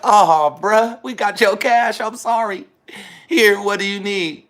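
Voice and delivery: apologetic staff voice. Retold or imitated. Restaurant worker apologizing to the customer, high and eager. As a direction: play the worker rushing to make it right. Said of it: "They was like".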